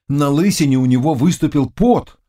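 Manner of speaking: plain reading voice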